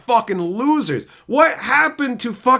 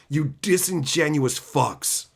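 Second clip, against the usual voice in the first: ordinary delivery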